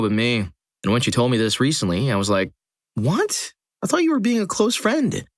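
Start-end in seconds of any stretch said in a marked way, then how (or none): 2.9-5.2 high, startled disbelief; surprised. Retold or imitated. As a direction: squeak it out in shock. Teller retells surprised reaction.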